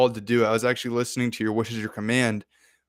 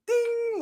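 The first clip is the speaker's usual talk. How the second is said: very high cartoon ding; imitated game jump sound. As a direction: mimic a video game jump chime.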